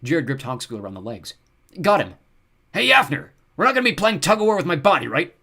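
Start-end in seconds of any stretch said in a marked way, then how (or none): 1.8-2.1 loud, raised announcement; urgent shout; calling out. A narrator's reading. Jared calls out during struggle. 2.7-5.4 high, loud goblin protest; frantic goblin yell, ending in a question; alarmed goblin. A narrator's reading. Goblin protests being pulled apart.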